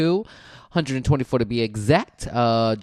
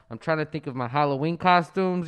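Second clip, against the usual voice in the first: ordinary talk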